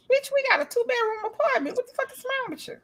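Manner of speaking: mock outrage at his nerve; exasperated retort. Retold exasperation at man.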